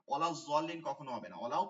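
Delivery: usual speaking voice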